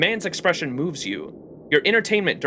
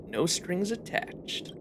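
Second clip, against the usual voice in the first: soft slow aside; 3 semitones higher, 11 dB quieter, much slower than usual. Acted. Drops the line very softly.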